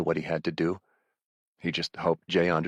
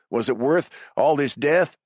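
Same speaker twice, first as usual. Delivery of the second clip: higher, louder desperate question; shouting; 7 semitones higher, 5 dB louder than usual. Acted. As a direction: shout it up at him to stall. Raven stalling the villain.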